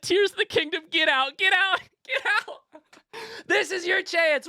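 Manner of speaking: high squeaky mock of a chat demand, laughing through it. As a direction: parody the viewer begging, giggling. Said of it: "Who said".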